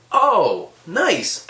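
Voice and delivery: high, loud "oh" of approval; impressed manager. Retold or imitated. Manager looking at the vape, mock impressed delight. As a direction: imitate delighted recognition. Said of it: "he looks at it and he's like".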